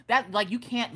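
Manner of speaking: ordinary talk, nothing marked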